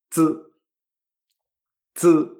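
z as a ts with throat vibration; z said as a voiced, unaspirated "tsz"; buzzing Chinese Z. Shown as a demonstration. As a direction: buzz the z like a bee, no i vowel. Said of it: "como el sonido de una abeja".

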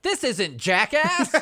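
slow, high pretend outburst; mock outraged shout. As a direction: storm out of a movie with a hammed-up protest. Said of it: "You shoulda jumped up and been like".

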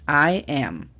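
"I am" said clearly, "am" with short a; teacher's clear "I am"; I am model. Shown as a demonstration. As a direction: model "I am" carefully. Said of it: "La pronunciación fonética es".

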